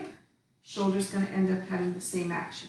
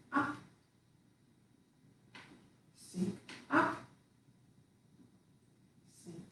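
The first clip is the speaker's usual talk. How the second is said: faster, softer rhythm of cues; quicker cues; 5 dB quieter than usual. Shown as a demonstration. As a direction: keep the count moving quicker. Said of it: "Let's do it a little bit quicker".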